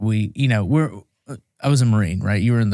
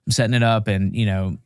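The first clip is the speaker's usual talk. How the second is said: plain reading voice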